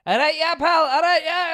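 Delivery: put-on high, rapid-fire Scottish accent; mock Scottish banter. Pals greeting once someone arrives, mock Scottish accent. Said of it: "when Scottish people aren't around other people".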